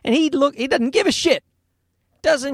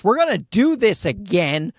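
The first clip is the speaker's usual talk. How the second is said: ordinary talk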